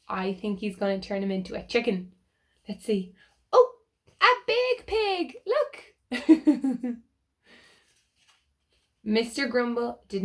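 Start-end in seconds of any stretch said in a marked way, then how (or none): 3.5-5.9 much higher, loud squeal of delight; delighted surprise. Real, not acted. Showing children the picture, squealing with excitement. 6.1-7.0 loud bright burst of laughter; delighted laugh. Real, not acted. Laughing with the children at the funny pig.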